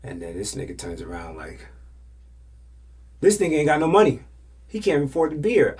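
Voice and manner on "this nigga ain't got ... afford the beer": higher, louder and fast taunt; mocking loud jab. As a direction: blurt the insult to embarrass him. Friend mocks him loudly.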